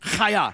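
teaching the crowd a guttural Chaya; throaty Hebrew chaya. Shown as a demonstration. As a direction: lead the room in a rasping Chaya. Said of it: "Everybody on the count of three".

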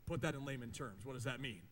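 lightly voiced listener, low and soft; a hint of a puzzled churchgoer, dropped low; questioning listener. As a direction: quietly put the listener's doubt. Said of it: "Now, you might say".

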